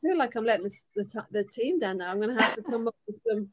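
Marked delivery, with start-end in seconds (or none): none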